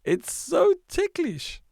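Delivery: tickled delight. Acted. Toes nibbled, Fred giggles.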